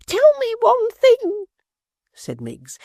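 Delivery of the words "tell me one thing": very high, slow, put-on fright; a prim maid squeaking out of the window; Miggs's voice.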